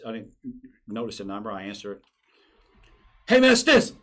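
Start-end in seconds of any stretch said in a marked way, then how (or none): 3.3-4.0 high, loud phone greeting; Stinson. Retold or imitated. Retired player calling his new friend, hard and loud. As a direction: bark it out high and hard, imitating him. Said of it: "That's how he talks, right?".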